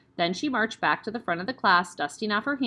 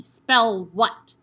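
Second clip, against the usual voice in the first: a loud sudden bark; barked demand; 8 dB louder than usual. Acted. Headmistress barks a spelling test at Eric. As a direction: snap the question out.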